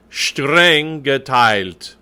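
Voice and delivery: "Streng geteilt" very slow, shtr start, clear l-t ending; every sound articulated. Shown as a demonstration. Coach drilling the choir, "Streng geteilt" with every sound in. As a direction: stretch "Streng geteilt", sounding both halves of ei. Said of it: "Make sure you get all those sounds in".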